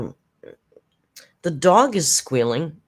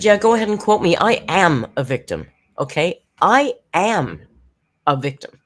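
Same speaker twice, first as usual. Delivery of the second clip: higher, louder mock-defiant outburst; defiant mock quote; 5 semitones higher, 7 dB louder than usual. Mocking the streamer's defiant claim with a loud, higher outburst. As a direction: throw her words back in a hammed-up shout. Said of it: "and she said".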